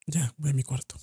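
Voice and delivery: soft unvoiced whisper; whispered retort. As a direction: breathe it out, barely there.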